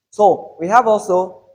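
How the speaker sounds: ordinary talk, nothing marked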